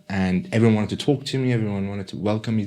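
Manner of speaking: normal talking voice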